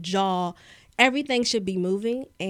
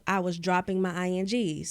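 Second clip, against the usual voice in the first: ordinary talk, nothing marked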